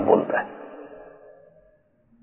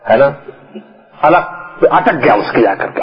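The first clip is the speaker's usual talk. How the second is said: usual speaking voice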